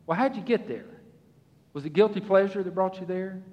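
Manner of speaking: usual speaking voice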